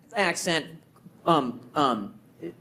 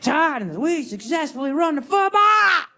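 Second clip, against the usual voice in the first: loud proud Titans boast; hype shout; 10 semitones higher, 4 dB louder than usual. Shown as a demonstration. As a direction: belt it like a proud fan. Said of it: "contrasting Titans with Volunteers".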